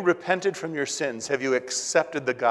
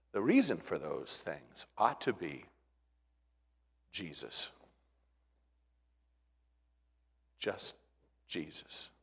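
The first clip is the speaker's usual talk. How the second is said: soft, low, near-whispered name; hushed; 8 semitones lower, 11 dB quieter than usual. Real, not acted. Pastor hushing to close the sermon, very soft. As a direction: let it fall to almost nothing.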